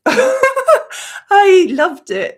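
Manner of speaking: slow, high, loud laughing words; emotional laugh. Real, not acted. Gushing about the film's ending, laughing and teary. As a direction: laugh it out through tears.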